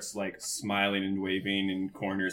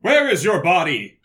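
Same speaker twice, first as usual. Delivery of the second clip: loud, higher startled question; 10 semitones higher, 13 dB louder than usual. Real, not acted. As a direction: thrown off by the voice. Player startled by bodiless voice.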